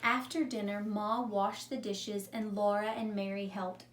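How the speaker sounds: calm read-aloud storytelling voice; story narration. A narrator's reading. Teacher reading a story to her class, calm narration. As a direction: read it like a story for young children. Teacher reads a picture book.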